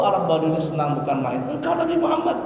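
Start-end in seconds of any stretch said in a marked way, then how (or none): 1.5-2.4 much higher, amazed disbelieving question; astonished. Retold or imitated. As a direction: gasp out the question in wonder. Bedouin learns who he is.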